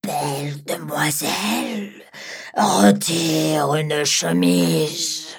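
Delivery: drawn-out dragon plea; slow dragon voice. Acted. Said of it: "le dragon lui demanda".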